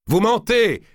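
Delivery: accusation criée, très haute et forte; cri de colère. A narrator's reading. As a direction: hurler le démenti, tremblant de colère. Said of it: "cria-t-il au grand maître". (Marigny crie au grand maître, furieux)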